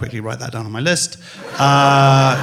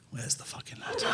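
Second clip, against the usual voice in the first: whispered thought; 18 dB quieter than usual. Retold or imitated. Both thinking, whispered.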